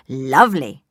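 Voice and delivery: low loud snarl. Acted. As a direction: snarl it before you catch yourself. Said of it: "snarled the wolf".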